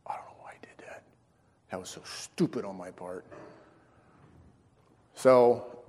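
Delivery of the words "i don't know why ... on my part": very soft, near-whispered regret; acted-out rueful murmur; hushed self-reproach. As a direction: mutter it low to yourself, ashamed. Regretful self-talk after forgiveness.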